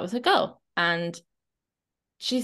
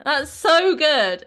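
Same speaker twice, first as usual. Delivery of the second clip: high, loud, slow "That's so good" with a long stressed so; big drawn-out praise, louder; emphatic praise; 7 semitones higher, 7 dB louder, much slower than usual. Shown as a demonstration. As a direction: really lean into the word so. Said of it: "like I'm saying should".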